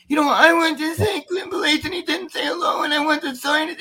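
lightly voiced child pleading, high; girl's voice. Host retells the wheelchair girl's words, high child voice.

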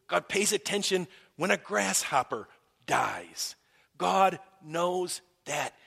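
loud, slow, emphatic preaching. Real, not acted. Pastor pressing the point on the congregation, loud and slow. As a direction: hammer each line home from the pulpit. Preaching builds to full force.

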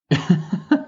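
short high laugh of disbelief; exasperated laugh. Real, not acted. After ranting about a three-week break, a short exasperated laugh. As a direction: laugh it off, fed up. Laughing at his own rant.

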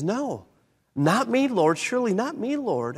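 high, pleading denial; protesting Peter. Retold or imitated. Peter protesting to Jesus, high and insistent. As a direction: insist it could never be you.

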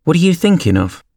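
high-pitched, mildly scolding question; severe. A narrator's reading. Mouse questions inattentive Alice, severely. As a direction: demand it with some severity. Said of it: "said the mouse to Alice severely".

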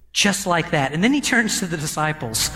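ordinary talk

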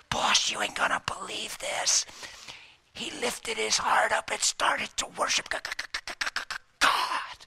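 whispered, choking on the word; stammering whisper. Acted. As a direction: stumble as if the name burns. Demon cannot say God.

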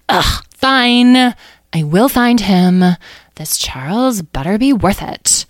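loud, slow grudging agreement; groan, then give in crossly; exasperated. Acted. Amethyst agrees to find Charles, exasperated.

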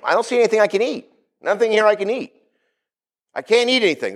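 a lightly acted frustrated Peter; hungry Peter. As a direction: whine that there's nothing to eat. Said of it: "Peter looks around and says".

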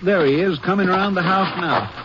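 ordinary talk, nothing marked

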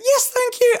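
imitating her terrified squeak, very high; squeaky mouse. Thanking the national icon while terrified, squeaky mouse. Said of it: "like a squeaky, squeaky mouse".

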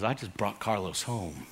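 hushed voice of God; gentle voice of God. Retold or imitated. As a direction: say it like a gentle father breaking news.

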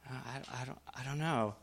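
softly unsure, as if thinking aloud; hushed, halting inner musing; imagined hesitant thought. Retold or imitated. Adam and Eve begin to doubt, hushed and hesitant. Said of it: "began to think".

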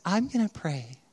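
softly imitated angelic wife; soft, sweet lilt, rising then falling. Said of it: "sounds angelic whenever Lauren talks".

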